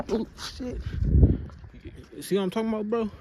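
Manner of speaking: sudden high startled outburst. Real, not acted. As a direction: jump at a big bug. Startled by a big bug.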